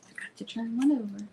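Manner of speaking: soft unvoiced whisper to herself; whispered aside. Real, not acted. Host whispering to herself while setting up, hushed. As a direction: mutter it under your breath.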